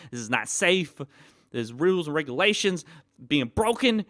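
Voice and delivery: building to a loud, indignant rant at the friend; angry rant. Retold or imitated. Telling off the friend on the roller coaster front seat, angry and loud. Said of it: "looking at him like".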